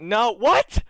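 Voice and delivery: loud, high-pitched astonishment; acted disbelief. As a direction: react in hammed-up shock. [name] reacts to caller's news.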